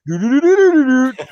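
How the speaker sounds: sung high console chime; console startup jingle. Retold or imitated. Imitating the Xbox startup sound to podcast mates. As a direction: hum the Xbox startup tune. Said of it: "that little button that goes".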